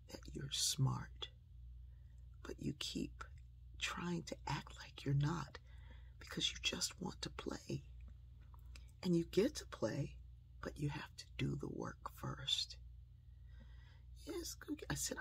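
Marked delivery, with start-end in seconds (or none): 0.1-12.8 soft, measured grandmotherly talk; hushed, patient reassurance; gentle firm counsel. Retold or imitated. Grandmother retells earnest talk to child. 14.3-14.9 put-on very high, very soft small-girl reply; tiny hushed high voice; little child voice. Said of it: "using a little voice".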